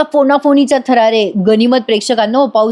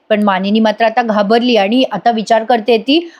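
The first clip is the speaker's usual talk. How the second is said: ordinary talk, nothing marked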